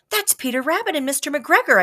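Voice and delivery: quick, high excited blurt. A narrator's reading. Child blurting to the lion, high and excited. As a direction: burst out with recognition. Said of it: "I blurted out".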